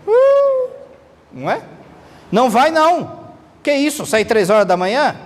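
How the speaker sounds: high squeaky mock wife's protest; nagging controlling wife. Controlling wife refuses husband leaving at night, mock high protest. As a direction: play a bossy wife scolding her husband. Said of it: "uma mulher aí, controladora".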